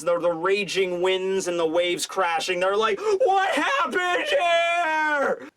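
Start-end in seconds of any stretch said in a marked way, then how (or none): 3.0-5.5 high, long drawn-out howl; mock wailing shout. Stranded men shouting into the raging sea, hammed-up wail. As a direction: stretch every word into a despairing yell. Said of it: "they're shouting off into the seas".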